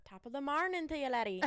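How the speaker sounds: quick stock-phrase Irish lilt; playful Irish accent with a laugh at the end. Shown as a demonstration. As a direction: toss off a stagey Irish greeting. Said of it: "How would you say it?".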